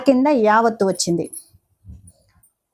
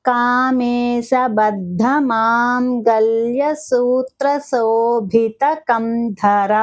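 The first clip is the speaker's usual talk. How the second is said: the whole name drawn out, ధర lengthened at the end; slow careful recitation; much slower than usual. Shown as a demonstration. Teacher modelling the full name for students, slow. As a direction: recite it slowly, stretching the last syllable. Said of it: "కొంచెం సాగదీసి పలకాలి".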